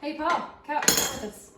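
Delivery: loud, slow call to count along. Real, not acted. Calling child to count.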